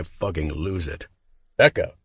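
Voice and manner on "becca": sharp, louder angry call across the room. Acted. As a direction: call her name, angrier than you mean to. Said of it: "I call her name more angrily".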